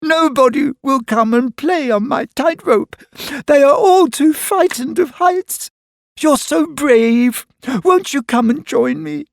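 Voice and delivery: lonely acrobat coaxing Mister Brave to join her; pleading. A narrator's reading. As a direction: coax him up sweetly, high. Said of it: "she sobbed".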